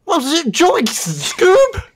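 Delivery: Shaggy impression. After fixing his posture, squeaky high Shaggy. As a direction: stretch it out like a goofy Shaggy. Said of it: "It sounded good".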